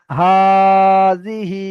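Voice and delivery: হাজিহি with হা held long, জি and হি short; long drawn vowel. Shown as a demonstration. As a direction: stretch only হা of হাজিহি. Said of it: "এটা টান হবে এবং বিজখানেরটা টান হবে না".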